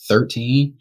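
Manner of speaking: ordinary delivery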